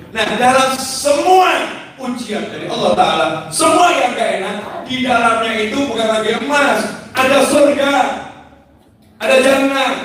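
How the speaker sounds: high-pitched, insistent sermon delivery; impassioned preaching. Real, not acted. Preacher urging listeners to stop complaining about hardship, impassioned.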